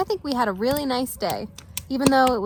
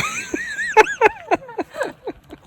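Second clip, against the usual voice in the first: shrieking laugh at herself; high squealing laugh. Real, not acted. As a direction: burst into shrill laughter. Laughs after loon call.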